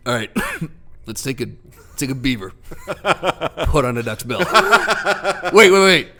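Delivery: lightly voiced God, laughing as he builds. God building the platypus, laughing and tinkering. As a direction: tinker and laugh like a careless creator. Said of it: "you see it out there going".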